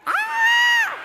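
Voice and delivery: very high, loud, long scream; shriek. A narrator's reading. Henry joining in after the count, piercing shriek. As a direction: shriek at the top of the range. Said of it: "shrieked Henry".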